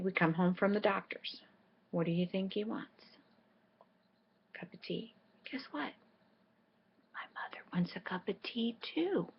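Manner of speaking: hushed unvoiced storytelling; whisper. Real, not acted. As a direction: drop to a whisper to tell the joke.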